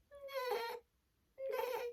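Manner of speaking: high, soft whinny; imitation of a horse; horse neigh. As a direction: neigh the word gently. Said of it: "the horse came along".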